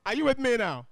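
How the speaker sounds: calling out. Real, not acted. Teacher checks on the class.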